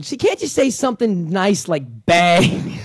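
mock mom suggesting a nicer word; imitating mother. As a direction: drawl it out like his mother. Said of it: "my mother is going".

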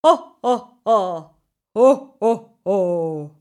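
loud hearty ho ho ho; jolly chuckle. Acted. King Icicle melting into laughter, a jolly loud chuckle. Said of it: "a really jolly, happy chuckle".

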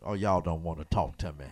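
usual speaking voice

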